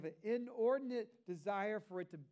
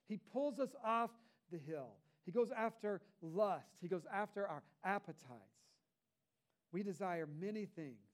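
ordinary delivery

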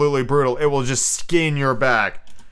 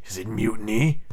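mildly pleasant menace; understated, sweetly put question; silkily pleasant. A narrator's reading. Hook sounding out his crew, menacingly pleasant. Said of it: "more pleasantly than ever".

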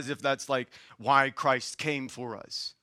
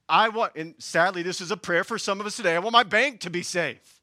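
ordinary talk

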